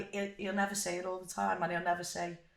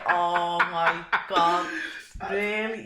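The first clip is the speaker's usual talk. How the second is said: stunned disbelief; 11 dB louder, much slower than usual. Retold or imitated. Shown video of herself.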